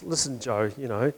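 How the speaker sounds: lightly voiced proper minister telling Joe off. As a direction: gently scold your friend like a proper clergyman. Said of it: "very proper".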